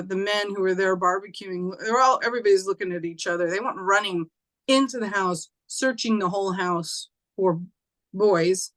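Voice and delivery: usual speaking voice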